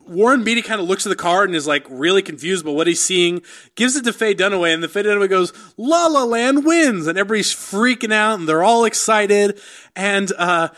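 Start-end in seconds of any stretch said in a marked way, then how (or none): 5.8-7.1 high, drawn-out award announcer; lightly mimicking a grand announcer; slow grand announcement. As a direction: draw out the winner's name like a presenter. Announcing the wrong winner.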